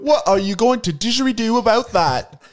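put-on Aussie pitchman; hammed-up Aussie commercial voice; Australian accent. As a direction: set up the sales pitch in broad Australian.

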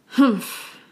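a short sulky humph; displeased humph. Shown as a demonstration. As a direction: huff humph with a pout. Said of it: "I don't like this".

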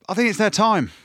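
a fan sure it's finally Ireland's year; excited. Real, not acted. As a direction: blurt it out with real excitement. Excited prediction of Ireland win.

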